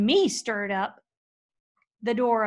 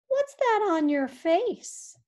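higher, slow, teasing cat; sly cat voice; 8 semitones higher, slower than usual. Acted. Pete the cat taunting Hank, slow and high. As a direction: drawl it out like a smug barn cat.

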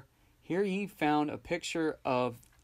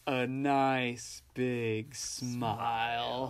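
very slow, lower, sarcastic drawl; 4 semitones lower, 3 dB quieter, much slower than usual. Retold or imitated. Podcaster imitating Sal's sarcastic line to Joker, slow and low. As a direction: stretch it out with sarcasm. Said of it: "he gives this really sarcastic".

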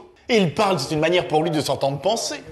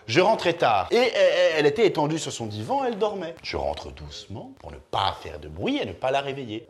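normal talking voice

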